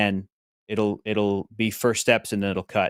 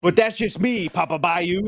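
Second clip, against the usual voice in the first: Gambit voice; 5 semitones higher than usual. Pretend. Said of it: "my own version of Gambit".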